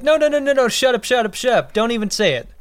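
high, rapid mock protest; retold frantic refusal. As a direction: cut them off in mock panic, easing slightly. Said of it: "and she's like".

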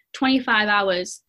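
ordinary talk, nothing marked

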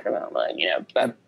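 mid-burp while drinking; talking through a burp. Real, not acted. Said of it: "a burp comes out like that".

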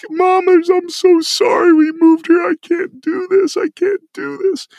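high, strained, tearful rush of words; sobbing teenager. Retold or imitated. Teen tells his mom about a brutal first practice, high and tearful. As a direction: pour it out like a crushed fifteen-year-old near tears. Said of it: "and I'm sobbing".

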